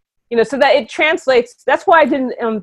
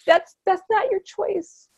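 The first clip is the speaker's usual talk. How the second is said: sad; 7 semitones higher, 6 dB quieter than usual. Real, not acted. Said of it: "it makes me sad".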